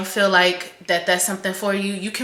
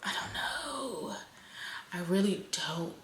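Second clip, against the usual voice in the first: whispered, much softer, fear showing through; scared whisper; 3 semitones higher, 12 dB quieter than usual. Real, not acted. Vlogger admitting to viewers that losing her job is scary, whispered. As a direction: let the fear slip out under your breath.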